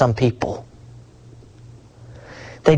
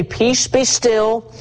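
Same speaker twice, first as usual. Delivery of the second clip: slow command; 8 semitones higher, much slower than usual. Acted. Preacher voicing Christ's command.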